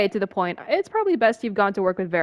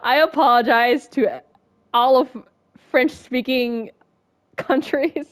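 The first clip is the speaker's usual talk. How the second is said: giggling through a mock apology, louder; laughing apology; 4 semitones higher, 6 dB louder, slower than usual. Real, not acted. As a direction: laugh at your own bad accent. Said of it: "So bad".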